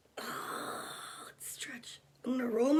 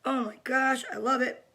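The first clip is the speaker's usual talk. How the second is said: ordinary talk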